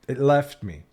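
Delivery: normal talking voice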